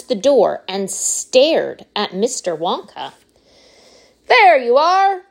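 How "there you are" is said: high, loud crow of victory; triumphant cry. A narrator's reading. Wonka proves the doubters wrong, crying out triumphantly. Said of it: "he cried triumphantly".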